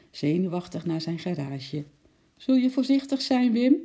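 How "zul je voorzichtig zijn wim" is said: high, louder worried call; naroepen. A narrator's reading. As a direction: call after someone leaving. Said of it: "riep zijn vrouw hem na". (met hoge, luide stem naroepen)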